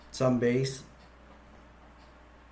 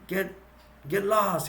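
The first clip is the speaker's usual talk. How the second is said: higher-pitched mock-angry dismissal; retold mad outburst; 7 semitones higher, slower than usual. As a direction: act out snapping at a pestering bird. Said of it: "He gets mad".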